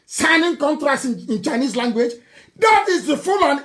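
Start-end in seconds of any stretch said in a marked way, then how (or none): none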